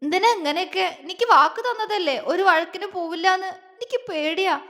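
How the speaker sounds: put-on a frightened girl near tears; tearful and scared. Wife tells husband she is scared, tearful.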